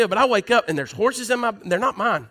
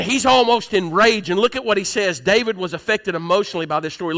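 ordinary talk

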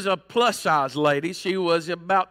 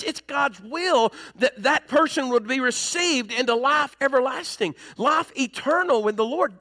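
higher, pressing sermon delivery; preaching at full force; 5 semitones higher than usual. Acted. Pastor to church on God's will, preaching hard. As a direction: drive the point home to the congregation.

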